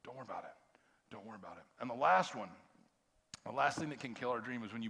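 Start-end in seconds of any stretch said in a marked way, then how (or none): 0.0-1.6 soft, low whisper of comfort; God calming a doubting person, whispered; gentle whisper. Acted. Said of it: "God says".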